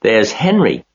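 put-on high, loud excited girl; excited call. A narrator's reading. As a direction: point him out eagerly. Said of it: "cried Fern".

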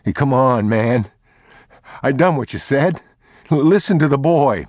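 high, loud, frantic pleading; a cornered thief begging the father; desperate thief. Acted. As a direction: plead for your life, voice cracking up. Thief pleads for his life.

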